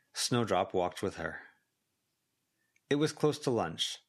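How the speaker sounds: ordinary delivery, nothing marked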